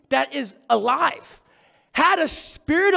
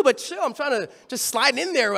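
ordinary delivery

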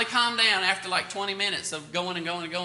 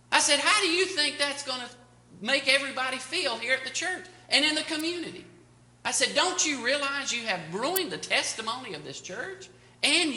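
retold rebuke; 6 semitones higher than usual. Pastor rebuking quarrelling couple.

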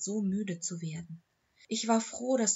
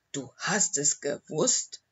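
slow, halting, lower disbelief; stunned stammer; 4 semitones lower, 3 dB louder, slower than usual. A narrator's reading. Owner learning his dog knew it all, stunned stammer. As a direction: falter through the question, stunned. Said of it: "stammelte er fassungslos".

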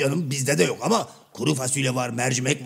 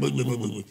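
comic babbling; 2 semitones lower, 5 dB quieter, faster than usual. Acted. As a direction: trail into a quick bubbling bı-bı-bı. Karagöz's nonsense babble.

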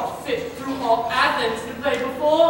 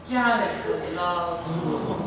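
stretched out slowly; slow and drawn out; 9 semitones lower, 6 dB quieter, much slower than usual. Acted. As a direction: draw the words out sadly. Quince drawls the lover's death.

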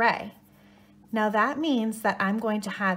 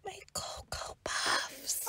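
whispering; 10 semitones higher, 8 dB quieter, slower than usual. Acted. Mourns lost cereal again.

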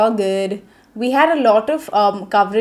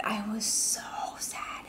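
soft breathless whisper of sadness; 14 dB quieter, slower than usual. Real, not acted. Whispering how sad she felt after losing. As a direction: hush it as if heartbroken.

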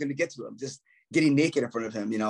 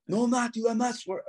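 put-on higher, whiny protest of a drunk bandmate; mock drunk denial; 9 semitones higher than usual. Drunk bandmate caught by the boss, a higher mock protest. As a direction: play the drunk guy insisting he's sober.

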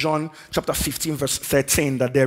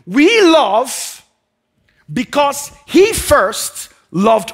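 full-force preaching; 8 semitones higher, 7 dB louder than usual. Acted. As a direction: drive each phrase home. Preaching builds again.

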